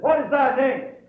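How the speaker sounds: high, loud preacher's demand; commanding question. Retold or imitated. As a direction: call it out with authority. Jesus commanding the demon.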